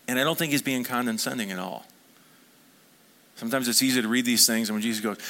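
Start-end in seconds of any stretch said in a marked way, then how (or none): none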